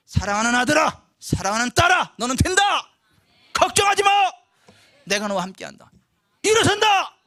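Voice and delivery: loud, high, ringing promise; proclaiming God's word. Acted. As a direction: call out God's assurance with full force.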